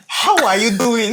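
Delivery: loud, high, slow and theatrical; over-the-top stage delivery. Shown as a demonstration. Actor shows the director his loud stage version of the greeting. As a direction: actor overdoing a simple greeting on set. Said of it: "Well, I'm like".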